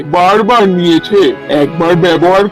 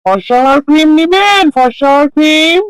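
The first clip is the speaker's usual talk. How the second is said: street vendor's cry; 6 semitones higher than usual. Acted. Seller calls passers-by to buy fairness cream, street vendor's cry. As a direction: hawk it down the street, stretching each phrase end.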